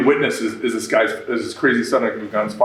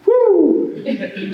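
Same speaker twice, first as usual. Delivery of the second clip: a sudden, much higher joyful whoop; excited whoop. Real, not acted. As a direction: let out a happy cheer like you just said yes to the dream. Celebrating starting the new company.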